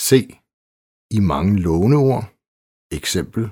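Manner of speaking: ordinary talk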